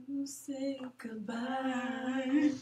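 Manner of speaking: slow, drawn-out mock goodbye; mock sad. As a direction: stretch it out like a sappy farewell.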